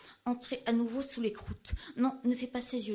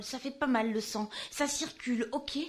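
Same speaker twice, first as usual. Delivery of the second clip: usual speaking voice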